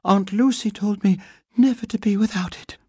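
high, breathless bear voice; panting. A narrator's reading. Paddington on the bathroom floor, still panting. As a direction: gasp it out after a fright. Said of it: "he panted".

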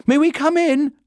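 high, loud call through a door; anxious shout. A narrator's reading. Judy outside the bathroom, shouting to Paddington. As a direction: shout urgently for permission to enter. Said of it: "she shouted".

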